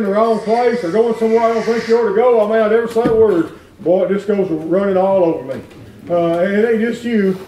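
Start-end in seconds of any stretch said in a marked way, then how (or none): none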